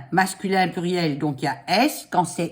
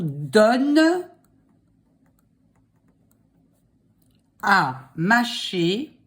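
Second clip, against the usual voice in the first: dictating; much slower than usual. Shown as a demonstration. Dictating slowly.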